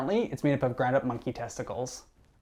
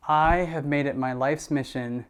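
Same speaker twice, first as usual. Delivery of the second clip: usual speaking voice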